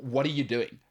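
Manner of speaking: ordinary delivery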